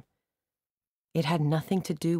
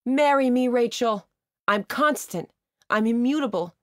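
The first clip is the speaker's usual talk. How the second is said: shouting; 9 semitones higher than usual. A narrator's reading. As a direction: declare it to the whole seal pond. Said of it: "stop shouting at you".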